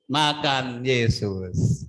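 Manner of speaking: "Makan Yesus" very slow, every word weighed; slow emphatic phrase. Shown as a demonstration. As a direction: drag out "Makan Yesus" for the congregation. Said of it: "pastor drills the phrase". (ucapkan "Makan Yesus" sangat lambat dan tegas)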